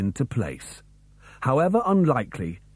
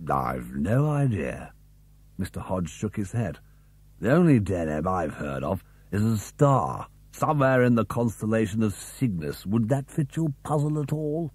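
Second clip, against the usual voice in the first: a hint of a puzzled schoolmaster; Mister Hodge; 4 semitones lower, 3 dB quieter than usual. A narrator's reading. Old teacher answering the boys.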